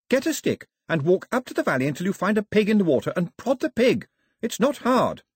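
put-on very high, forceful girl's voice; high-pitched, bossy young heroine; Daphne, exasperated. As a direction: hand out orders impatiently, pitched well up. Narrator voices Daphne ordering people about.

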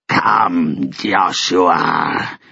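a touch of a growling dog-creature; growled. A narrator's reading. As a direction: call him out like a predator. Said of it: "Snowy growled".

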